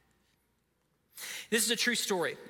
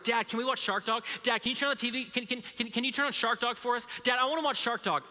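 higher, rapid-fire pestering son; over-caffeinated kid; 4 semitones higher, faster than usual. Retold or imitated. Son begging dad for a show, hyper pestering. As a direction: pester breathlessly like an excited little boy. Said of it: "drank a cup of coffee".

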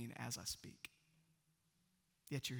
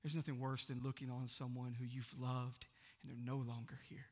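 normal talking voice

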